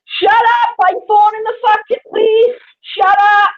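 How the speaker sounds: a high, loud yell of shut up; furious shout. Real, not acted. Yells at fighting neighbours.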